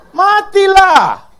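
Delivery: loud, high, very slow stretched cry; drawn-out shout. Acted. Preacher repeats the punchline to the laughing congregation, drawn out. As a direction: stretch the word out in a long loud wail.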